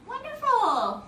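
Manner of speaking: high, slow, drawn-out praise; delighted praise. Real, not acted. Teacher praising kids after butterfly pose, high delighted praise. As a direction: stretch it out high, then let it fall.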